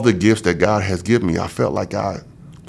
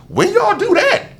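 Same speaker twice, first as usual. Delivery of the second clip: startled question. Retold or imitated. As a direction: react like you just noticed the blood bags. Sees blood vats, caught off guard.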